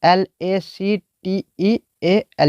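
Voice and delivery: slow, letter by letter spelling; spelled out letter by letter. Shown as a demonstration. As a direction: spell LACTEAL slowly for the class. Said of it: "इसको लिख देते हैं हम यहाँ पर".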